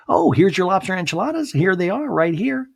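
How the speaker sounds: high-pitched singsong waiter interrupting; chirpy waiter. Retold or imitated. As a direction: play an oblivious waiter delivering plates. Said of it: "the waiter's like".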